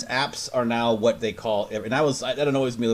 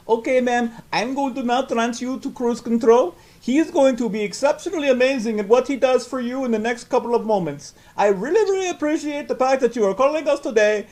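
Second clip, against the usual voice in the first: high, louder put-on voice; mock transfer agent; 13 semitones higher, 5 dB louder than usual. Mocking an agent transferring a call, high overly polite voice. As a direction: gush like a scripted operator. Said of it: "they'll be like".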